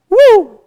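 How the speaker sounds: high, loud joyful woo; a preacher's whoop from the pulpit; excited whoop. Real, not acted. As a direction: let out a big whoop of joy. Preacher cheers his own point.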